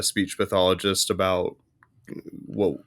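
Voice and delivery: normal talking voice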